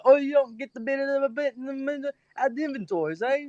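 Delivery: high-pitched, garbled British shopkeeper; mock British accent. Reader mimics the book's shopkeeper for a friend, mock British accent. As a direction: put on a squeaky broken-English British voice. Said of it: "Oh, he sounds British".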